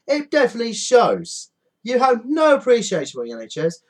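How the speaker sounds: high, loud furious scolding; shouting at panic buyers, high and furious; furious rant. Real, not acted.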